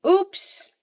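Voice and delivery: high, loud playful oops; a squeaky little accident; mock oops. Group spills the Stardust.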